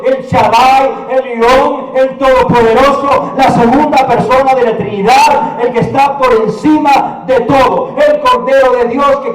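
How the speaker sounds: driving, emphatic litany of titles; sustained ringing sermon climax; full-force preaching. Acted. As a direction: proclaim each title without letting up.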